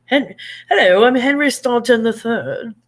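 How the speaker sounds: lightly voiced posh Englishman introducing himself; playful posh British impression; mock posh British. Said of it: "he sounds so posh".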